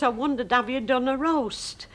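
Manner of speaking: a hint of a Lancashire woman asking a favour; Lancashire speech. Neighbour asking the mother in broad Lancashire. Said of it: "a lovely way to speak Lancashire".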